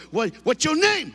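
put-on high, loud snapping kid voice; angry kid. Furious kid at the front desk, loud high bark. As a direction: bark it like a furious seventh grader. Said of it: "the angriest little brother".

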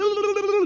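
very high muffled blah noise; muffled babble. Retold or imitated. The girl's muffled yells under his fat, high babble. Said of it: "it came out, blah".

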